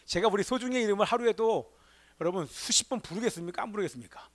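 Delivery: normal talking voice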